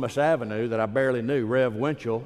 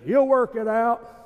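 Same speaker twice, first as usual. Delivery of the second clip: put-on old Floyd's voice; 11 semitones higher than usual. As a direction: voice the old man with a warm laugh. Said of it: "you know what he said?".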